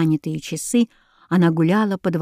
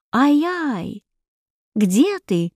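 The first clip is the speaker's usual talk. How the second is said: understated cry of alarm, pitch jumping at first; mild startled cry; 5 semitones higher, much slower than usual. A narrator's reading. Princess loses sight of her hedgehog, mildly startled cry. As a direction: call out lightly, startled. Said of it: "вскрикнула Азелиза, не видя ёжика".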